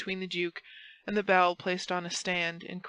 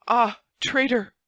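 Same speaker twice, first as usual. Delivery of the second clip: loud, slow, raised cry; 4 semitones higher, 8 dB louder, slower than usual. A narrator's reading. Buckingham stabbed by Felton, crying out loudly. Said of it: "cried Buckingham".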